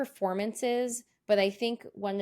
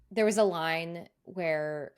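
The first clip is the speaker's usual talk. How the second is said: usual speaking voice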